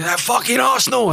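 high mock roar of an enraged fan; hammed-up fury at Arsenal, pitched high and loud; imitating angry fan. Fan furious at Arsenal, a mock high yell. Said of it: "He was so angry".

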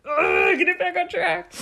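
high-pitched strained self-scolding; groan, then urge yourself on in mock desperation; mock frustration. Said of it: "and I was like".

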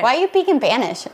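"speak in Spanish" as "peak in Panish"; child's missing S's. Retold or imitated. Mom repeats her son's question, "speak" as "peak". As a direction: imitate a kid saying "Spanish" without its S, "Panish". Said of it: "he couldn't say his S's".

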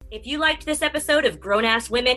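loud, bright promo read; podcast outro read. Acted. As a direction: sell the outro like an announcer.

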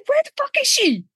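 high-pitched incredulous demand; exasperated outcry. Real, not acted. Podcaster demanding to know where a missing character is, exasperated. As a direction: voice leaping up in comic exasperation.

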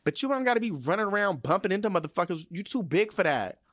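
exasperated scolding. Acted. Customer telling someone to stop running through the barbershop, exasperated. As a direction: scold them with swooping emphasis on the commands.

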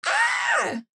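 high shout into next topic; sudden shout. Real, not acted. Sudden outburst moving to next topic.

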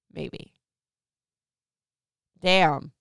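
ordinary talk, nothing marked

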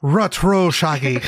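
Irish-accented cartoon dog. Translating Scooby-Doo for Ireland, mock Irish accent. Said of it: "Do it in the Irish accent".

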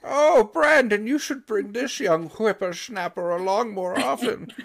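put-on high, slow old granny voice; old lady voice. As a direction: dote like a sweet old grandma. Grandma voiced in story.

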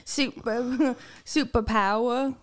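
slow Australian with dropped r; Australian accent. Shown as a demonstration. Host repeats her sample word, slow Australian accent. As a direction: draw out the Australian vowels. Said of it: "That's Australian".

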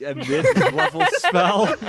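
high, loud laugh breaking into the words; laughing disbelief. Real, not acted. Player reacting to the spell's level, laughing in disbelief. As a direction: laugh through an incredulous question.

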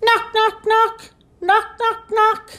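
high, rhythmic knocks in bursts; high-pitched knocking imitation; door knocking. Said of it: "like a hand on the door".

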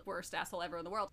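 ordinary delivery

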